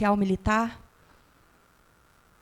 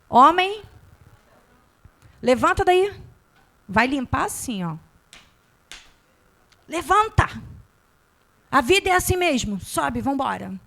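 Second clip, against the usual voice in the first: put-on loud, harsh shout at a fallen boy; gruff father yelling; 3 semitones higher, 5 dB louder than usual. Father yelling at his fallen son to get up. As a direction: bark it like a tough dad who won't coddle.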